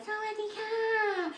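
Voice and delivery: very high, slow Thai "Sawadee ka"; mock Thai. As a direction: sing it up high, Thai style. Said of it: "high and nasally".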